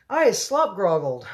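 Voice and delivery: high, loud squawk; giant's squawk. A narrator's reading. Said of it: "squawked the gizzard gulper".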